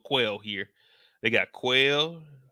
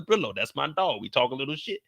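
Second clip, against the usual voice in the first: usual speaking voice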